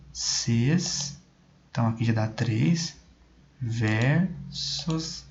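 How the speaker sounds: "Rasga e, ses" split into slow syllables, counted aloud; slow syllable-by-syllable scansion of the verse; counting poetic syllables. Shown as a demonstration. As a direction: tap out each poetic syllable of "Rasga esses versos" one by one. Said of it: "vamos ver se eu consigo contar".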